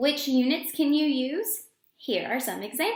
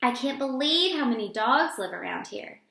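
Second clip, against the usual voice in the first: ordinary delivery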